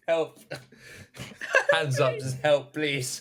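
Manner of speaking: laughing mock cry for help; hammed-up scared kid, laughing; mock plea laughing. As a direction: plead for help through giggles. Mocking a scared child through laughter.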